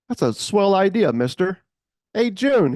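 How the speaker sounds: high, loud, hearty diner-owner cheer; hearty enthusiasm. Retold or imitated. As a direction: sell it like a big friendly cook.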